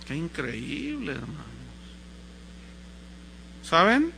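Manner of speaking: usual speaking voice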